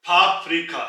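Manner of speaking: फाफ्रिका said loudly, falling at the end; teacher's model word. Shown as a demonstration. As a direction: say फाफ्रिका clearly for the class. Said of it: "teacher models the word". (फाफ्रिका को ज़ोर से, गिरती लय में)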